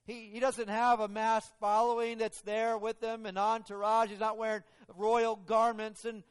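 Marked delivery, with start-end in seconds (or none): none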